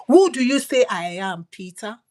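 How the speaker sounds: ordinary talk, nothing marked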